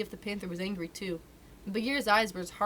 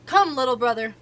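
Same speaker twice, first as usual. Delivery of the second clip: calling out; 4 semitones higher, 10 dB louder than usual. Acted. Bagheera calls Mowgli down.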